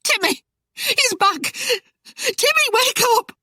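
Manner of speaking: very high-pitched panicked wailing; blubbering. A narrator's reading. Frightened wife waking her husband, blubbering. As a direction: wail it in a squealing, tearful voice. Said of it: "she blubbered".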